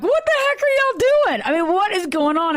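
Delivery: shrieking what the heck, woken from bed; roused friend yelling at the noisy group; startled friend. Retold or imitated. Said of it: "He's like".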